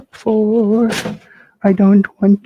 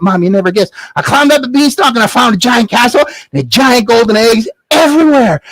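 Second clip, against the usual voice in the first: ordinary delivery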